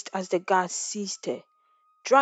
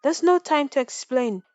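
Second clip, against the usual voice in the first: hasty reply; 6 semitones higher, 4 dB louder than usual. A narrator's reading. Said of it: "the servant replied hastily".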